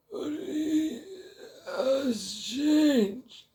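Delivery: put-on very high, very slow, otherworldly old man's voice; eerie old hermit. Old forest hermit greets Zarathustra in a very high, slow, eerie voice. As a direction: stretch each word out like a strange ancient hermit.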